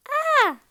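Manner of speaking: big high shout "Ah!"; teacher shouting the song ending. Shown as a demonstration. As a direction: yell "Ah!" in mock alarm. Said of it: "And then we shout".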